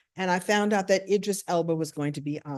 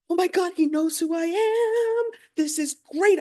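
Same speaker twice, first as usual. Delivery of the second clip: high gasping gush, stretched out; gasp, then squeal with mock awe; starstruck thrill; 10 semitones higher, 3 dB louder than usual. Host learning Idris knows her, starstruck delight.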